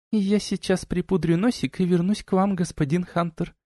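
put-on high, soft chirpy girl voice; chirping bird person. A narrator's reading. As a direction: chirp it sweetly, light and high. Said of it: "прощебетала девчонка".

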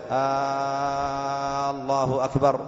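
first vowel of "الله أكبر" held very long; "آالله أكبر" with a long, level first vowel; wrongly stretched hamza. Shown as a demonstration. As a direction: drag out the opening "آ" of "الله أكبر". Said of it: "لا يمد الهمزة".

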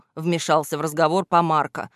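ordinary delivery

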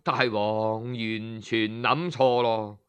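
usual speaking voice

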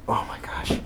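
breathless whispered awe; whispered gasp. Acted. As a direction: whisper it, stunned. Entering the haunted house.

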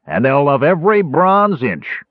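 high, mocking dwarf's hoot. A narrator's reading. Arak teases Caramon about the ladies, hooting. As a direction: hoot it in scornful glee. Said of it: "Arak hooted".

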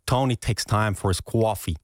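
Brooklyn American practice sentence; Brooklyn accent. Shown as a demonstration. Guest modelling the sentence for host, Brooklyn accent. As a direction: model the Brooklyn sound for a learner. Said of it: "dan heb je uiteindelijk het zinnetje".

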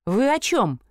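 ordinary delivery, nothing marked

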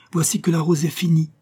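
crying out. A narrator's reading. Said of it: "cria le rosier".

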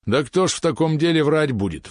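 mildly affronted, voice rising high; indignant. A narrator's reading. Прохоров defends the claim about sродство, indignant. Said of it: "возмутился Прохоров".

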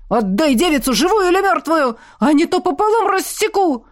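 yelling at full strain. A narrator's reading. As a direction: roar it till the voice strains. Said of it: "надрывался Тихон".

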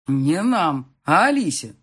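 loud, emphatic fatherly correction; didactic. A narrator's reading. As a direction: lecture her firmly, word by word. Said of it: "назидательно сказал папа". (назидательно)

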